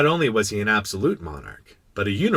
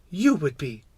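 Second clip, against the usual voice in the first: put-on higher, decided child voice; firm little prince; 6 semitones higher than usual. Little prince judging the king at fault, firm. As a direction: answer the king firmly. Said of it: "said the little prince quite firmly".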